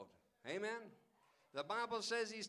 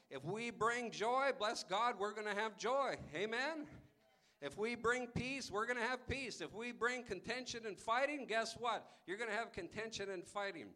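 louder, building preacher cadence; preaching; 4 semitones higher, 4 dB louder than usual. Acted. Preacher urges the congregation, rising and emphatic. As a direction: hammer each line home to the congregation. Preacher drives home the point.

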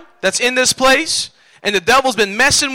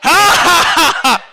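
hearty laugh; 5 semitones higher than usual. Real, not acted. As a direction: burst out laughing at own joke. Joking that pastors gotta eat.

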